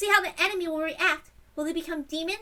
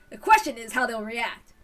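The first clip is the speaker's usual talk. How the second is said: normal talking voice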